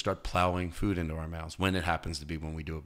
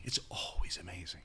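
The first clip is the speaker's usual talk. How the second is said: softer unvoiced whisper of It's always amazing; 10 dB quieter than usual. Shown as a demonstration. An awed whisper of It's always amazing. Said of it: "repeated as whisper".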